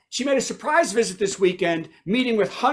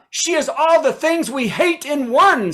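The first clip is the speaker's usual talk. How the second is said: high, mocking news anchor; mock Fox News quote; 6 semitones higher than usual. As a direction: sneer it like a pundit. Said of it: "said Fox News".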